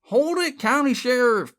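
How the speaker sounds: high, loud lawman's call; yelled command. A narrator's reading. Sheriff bursts through the door, yelling. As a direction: yell it to freeze the room. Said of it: "he yelled".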